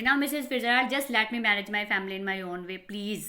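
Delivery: normal talking voice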